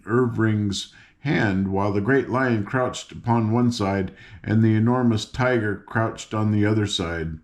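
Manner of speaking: usual speaking voice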